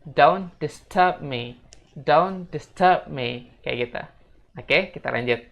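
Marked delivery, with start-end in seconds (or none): 0.0-3.5 "don't disturb me" very slow, word by word; slow repeat-after-me "don't disturb me"; pronunciation model. Shown as a demonstration. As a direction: stretch "don't disturb me" for learners to copy. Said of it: "teman-teman bisa ikutin aku ya".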